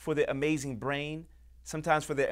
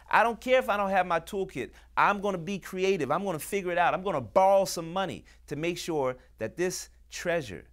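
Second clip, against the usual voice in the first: higher, impassioned determination; fired-up resolve; 6 semitones higher, 4 dB louder than usual. Acted. Welcomer vowing to keep a young newcomer, fired-up resolve. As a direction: vow it with rising urgency.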